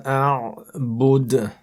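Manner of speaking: Quran recitation. Shown as a demonstration. Teacher reciting for students, melodic tajweed chant.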